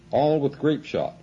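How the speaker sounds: narrated shout. A narrator's reading. Officer orders his battery to fire grape shot, a restrained shout. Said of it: "shouted the officer".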